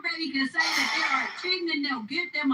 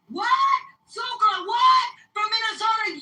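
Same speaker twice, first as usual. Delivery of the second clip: higher and louder, an incredulous shout of surprise; shocked outburst; 8 semitones higher, 6 dB louder than usual. Retold or imitated. Stunned person shouting at the news from Minnesota. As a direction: blurt it out like you can't believe what you heard. Acting out shocked reaction to enrolling.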